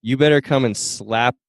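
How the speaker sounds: normal talking voice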